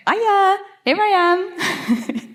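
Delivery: high, drawn-out mouse hello; mock mouse greeting. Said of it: "go up to a cat and go".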